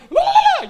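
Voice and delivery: very high, loud penguin honk; shrieked wordless cartoon babble; Pingu's babble. Imitating the penguin on screen, very high loud babble. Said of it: "when he'd be on screen like".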